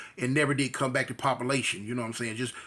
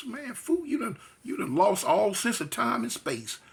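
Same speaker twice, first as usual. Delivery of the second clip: ordinary talk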